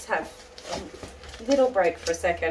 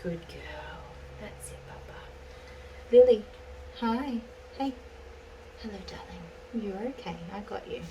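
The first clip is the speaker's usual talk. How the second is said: much softer, near-whispered comfort; soft soothing hush; 8 dB quieter than usual. Real, not acted. Groomer hushing her frightened dog, soft near-whisper. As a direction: murmur gently so she settles.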